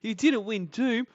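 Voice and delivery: high, loud mocking whine; mocking her partner's fake-cutesy tone; mocking boyfriend. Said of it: "he thinks this is cutesy".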